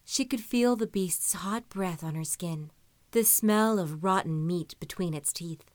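usual speaking voice

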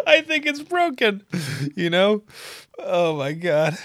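half-laugh half-wince about the leg; laughing pained. Real, not acted. Runner joking about a broken leg, laughing high. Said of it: "bone is kinda sticking out".

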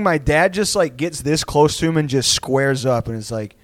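normal talking voice

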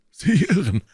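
high-pitched, self-important denial; boastful Porthos. Acted. (selbstgefällig, mit hoher Stimme)

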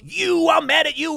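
high, cartoonish indignation; hammed-up confrontation with a stranger; mock angry accusation. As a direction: put on a silly high voice of outrage. Same angry man accosts passerby.

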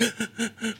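sharp gasping breath in; a crying kid's gasp, breathy; gasp between sobs. Retold or imitated. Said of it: "through, like, breaths".

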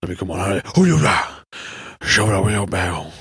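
low, slowing Southern crooner's thanks. Acted. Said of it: "I always talk like this".

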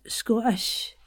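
"Scottish" with a longer O, Scots style; Scottish-accented "Sco'ish". Shown as a demonstration. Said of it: "remember the O it changes".

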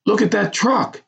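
high-pitched amazed exclamation; excited. A narrator's reading. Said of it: "he said excitedly".

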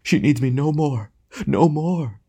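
high, theatrical choked lament; choking voice. A narrator's reading. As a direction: ham tragedian overcome, to nobody in particular. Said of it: "he said in a choking voice".

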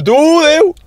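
very high loud mock goodbye; taunting kid. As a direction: wave off the chasing boy. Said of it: "y yo". (despedida burlona muy aguda)

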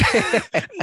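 loud high-pitched laugh; high burst of laughter. Real, not acted. Laughing at the joke.

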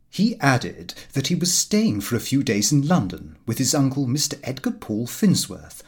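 usual speaking voice

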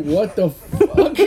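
spluttering with laughter at the scene; retold shock dissolving into a laugh; laughing disbelief. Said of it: "And he's like".

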